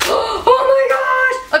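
high, slow, drawn-out squeal; real delighted shriek; thrilled shriek. Real, not acted. Shrieks opening the box.

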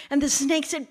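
plain reading voice

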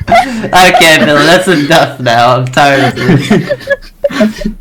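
talking through laughter; loud, high, helpless laughing protest. Real, not acted. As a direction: drag the words out while cracking up. Cracking up at the mock voicemail.

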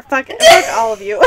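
loud, high joking shout; mock outburst. Host jokingly yelling at the audience, loud mock shout. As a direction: yell it at everyone in fun.

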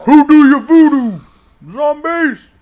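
high, loud taunting call; playful shout. Pretend. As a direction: holler playfully at the zombies. Calls out at zombies.

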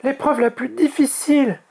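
put-on very high, anxious young dragon; high-pitched apprehension; worried. A narrator's reading. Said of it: "s'inquiéta Oria".

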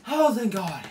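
a high, loud burst of relief. Real, not acted. Surviving the boss's attack, a loud relieved cry. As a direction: gasp it out like a reprieve.

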